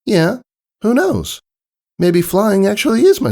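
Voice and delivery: put-on high, eager young filly. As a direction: lift the voice way up into a girl pony. Scootaloo voiced by narrator.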